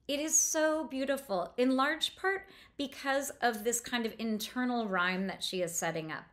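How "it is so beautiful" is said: ordinary talk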